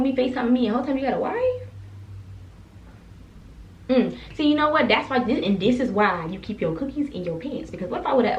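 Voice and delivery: ordinary talk, nothing marked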